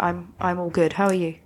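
ordinary talk, nothing marked

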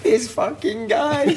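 high, drawn-out mock despair; mock exasperation. Teammate despairing at the striker, high slow exasperation. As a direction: imitate a teammate wailing in disbelief.

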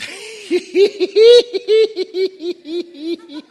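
high-pitched, long, staccato cackle; hammed-up laugh running on like a laugh box; mock laughing fit. Preacher acting out Sarah laughing at God's news, mock laughing fit. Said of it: "she started laughing".

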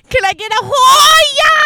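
shout. Retold or imitated. Imitating a shouted demand.

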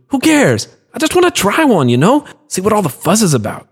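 high, carefree and breezy; excited shrug. Acted. Young watchman brushing off Carlos's warning, high and breezy. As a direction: wave off the warning eagerly.

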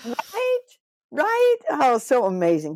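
very high excited squeal of right; gushing agreement. Real, not acted. Host agreeing that the squirrels are cute, squealing high.

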